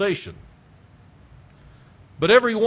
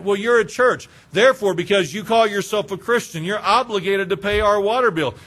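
louder, entitled caller voice; entitled demand; 3 semitones higher, 4 dB louder than usual. Retold or imitated. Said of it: "some of them believe the churches are obligated".

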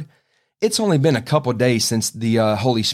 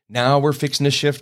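usual speaking voice

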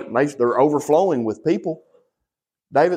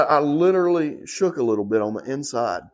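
usual speaking voice

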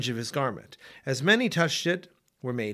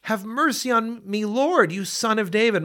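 a mother's raised, higher plea for mercy; mildly pleading woman, pitched higher; pleading cry; 7 semitones higher than usual. A narrator's reading. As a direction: cry out to him, lightly voiced. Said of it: "came out from those borders and cried".

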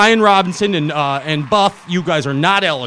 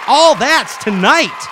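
hyped up; 6 semitones higher, 6 dB louder, slower than usual. Acted. Hyped show intro.

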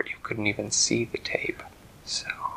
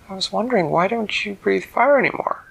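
put-on high girl voice; 9 semitones higher, 6 dB louder than usual. A narrator's reading. Girl asks the dragon.

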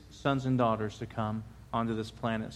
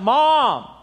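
loud, high yell for mother; child's raised call from another room; boy yelling out; 10 semitones higher, 8 dB louder than usual. Acted. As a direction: holler for mom like a kid in bed. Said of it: "he yells out".

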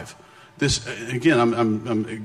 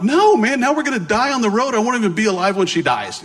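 high, loud, hammed-up panic; panicked protest; 10 semitones higher, 4 dB louder than usual. Protesting to the missionary turning the car, panicked. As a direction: wail it in mock despair, easing down towards the end.

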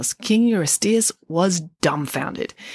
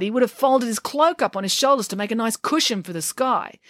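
plain reading voice

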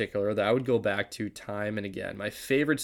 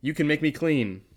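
raised, loud appeal; pleading; 6 semitones higher, 6 dB louder than usual. A narrator's reading. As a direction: plead earnestly, pitched up. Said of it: "begged him on his knees".

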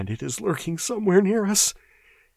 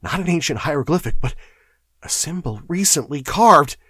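high-pitched, alarmed clerk; frightened chatter; 12 semitones higher, 5 dB louder than usual. A narrator's reading. Shaking clerk warning of a lurking horror, frightened. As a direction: warn them with mounting dread. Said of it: "chattered Primero".

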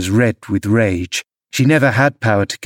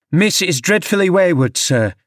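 raised-pitch shout to someone at a distance, restrained; calling out; 8 semitones higher than usual. A narrator's reading. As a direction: call after him to get his attention. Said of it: "I called out".

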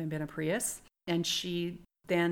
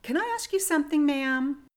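much higher, quick and loud imitation of the girl; pitched-up polite challenge; imitating young woman; 10 semitones higher, 6 dB louder than usual. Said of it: "and said".

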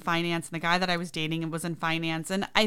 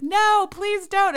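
high, drawn-out mock scream; hammed-up panicked begging; retold screamed plea; 13 semitones higher, 6 dB louder, much slower than usual. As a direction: plead loudly, stretching it way up high. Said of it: "and I'm screaming".

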